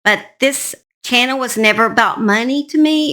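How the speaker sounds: ordinary delivery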